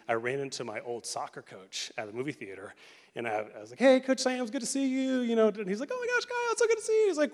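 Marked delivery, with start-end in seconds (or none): 3.8-5.2 high, loud happy hello; acted-out delighted greeting to an old coach; excited greeting. 5.9-7.1 very high, loud, delighted hello; hammed-up squeal of recognition; gushing greeting. Coach greets him back.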